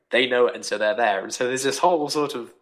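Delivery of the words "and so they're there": ordinary talk, nothing marked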